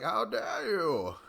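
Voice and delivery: high, slow, drawn-out how dare you; haughty offended snob. Pretend. Mocking Rogan as a pompous Brit, high and drawn out. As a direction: pour on the pompous outrage. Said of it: "if he was just like".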